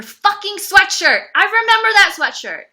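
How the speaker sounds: high, loud, indignant outburst; outraged cry, pitched high and loud. Real, not acted. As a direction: belt it out in disbelief. Outrage at the sweatshirt.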